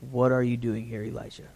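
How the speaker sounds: softly voiced divine question, fading away; gentle whisper. The voice of God asking Elijah softly, quiet. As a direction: ask it low and hushed, as God to Elijah. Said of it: "came a gentle whisper".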